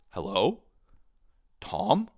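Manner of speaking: confused sister on the phone. Retold or imitated. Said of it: "still answers the phone like this".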